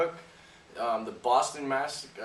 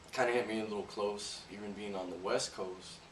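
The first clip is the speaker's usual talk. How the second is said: ordinary talk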